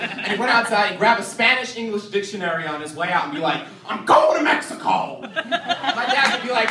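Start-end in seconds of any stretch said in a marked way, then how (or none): 3.9-5.2 high, loud defiant declaration; hammed-up tantrum yell; super pissed off. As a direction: imitate a furious boy announcing his exit. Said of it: "super pissed off about something".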